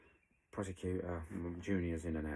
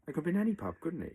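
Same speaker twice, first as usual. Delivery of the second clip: incredulous outburst; 12 semitones higher, 9 dB louder, much faster than usual. Real, not acted. Sarcastic point about the coincidence.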